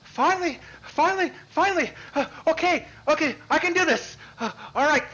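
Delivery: high, loud, breathless bursts; excited relief. Acted. Game master voices a character finally getting her turn, excited. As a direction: pant out each short exclamation, high and loud.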